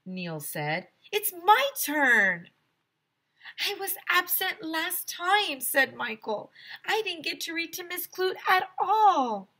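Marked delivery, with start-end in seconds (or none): 1.1-2.5 put-on arguing kid. A narrator's reading. Kids arguing over reading turn. 3.6-9.5 put-on whiny kid. A narrator's reading. Kids arguing over reading turn.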